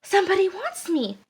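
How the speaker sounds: light up with excited hope. A narrator's reading. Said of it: "the duck got excited".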